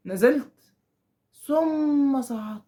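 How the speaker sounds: "ثم" stretched out, "صعدت" eased in; teacher acting out a slow sequence. Shown as a demonstration. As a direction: drawl each word to show a long gap. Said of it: "في بطء".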